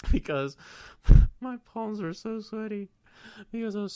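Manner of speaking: real embarrassed laughter; laughing. Real, not acted. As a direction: crack up while telling it. Laughing at own embarrassing story.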